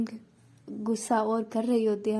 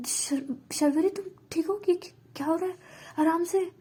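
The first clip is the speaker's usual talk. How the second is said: higher, urgent and frantic; panicked; 6 semitones higher than usual. Acted. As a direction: panic over a friend who is collapsing. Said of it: "panic करने लगती है".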